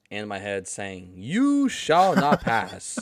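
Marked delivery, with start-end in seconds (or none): 1.2-3.0 slow booming wizard command; Gandalf. Retold or imitated. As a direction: thunder it out like a wizard. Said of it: "I heard Gandalf".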